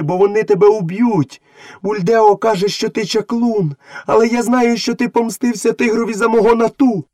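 high-pitched, pleading call; woman crying out. A narrator's reading. Mother urging her son to flee, crying out. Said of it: "підбігла до череди і закричала".

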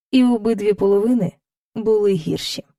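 normal talking voice

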